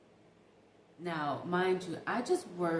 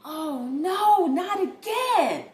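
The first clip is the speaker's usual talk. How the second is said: much louder, higher, slow dismayed cry; groaning new neighbor; 10 semitones higher, 11 dB louder, much slower than usual. Retold or imitated. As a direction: drag it out like a man woken by the fight. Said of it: "I can hear him say".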